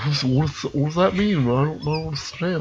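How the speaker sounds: quiet imitation of someone baffled; retold confusion. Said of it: "he's like".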